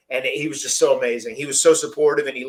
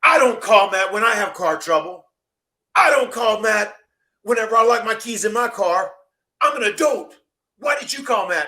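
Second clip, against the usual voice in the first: angry rant; 8 semitones higher, 3 dB louder than usual. Retold or imitated. Dad scolding Shane for not calling for help, angry rant. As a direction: scold the grown man like a child. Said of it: "my dad got so hot".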